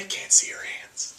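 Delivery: hushed whisper, no voice; whispered aside. Real, not acted. Host privately prompts his guest on camera, whispering. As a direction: whisper a quick correction off the record.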